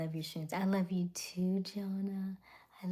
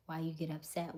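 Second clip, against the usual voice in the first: usual speaking voice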